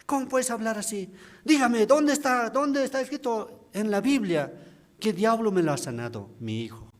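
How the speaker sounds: high, indignant outburst at a youngster; how dare you, boy, outraged; angry deacon. Retold or imitated. Deacon furious at the young preacher, indignant shout. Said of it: "se ha enojado conmigo".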